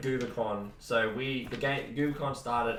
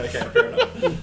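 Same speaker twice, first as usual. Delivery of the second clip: laughing; 8 dB louder than usual. Real, not acted. Conceding, laughing.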